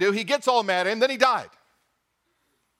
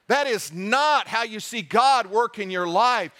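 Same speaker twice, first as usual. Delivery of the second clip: usual speaking voice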